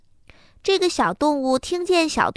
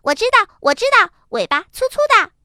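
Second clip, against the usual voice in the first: high, loud, quick chirp; blurting little rabbit; 9 semitones higher, 4 dB louder, faster than usual. A narrator's reading. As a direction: interrupt the goat, bragging. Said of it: "又嚷嚷起来说". (又高又快地抢话嚷嚷)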